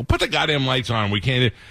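insist, acting fed up, at ordinary volume; retold angry demand. Showgoer refuses the lighting guy's excuse, high-pitched retold anger. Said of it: "I got mad".

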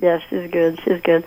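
ordinary talk, nothing marked

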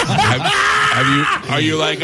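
squealing laughing question; laughing high. Real, not acted.

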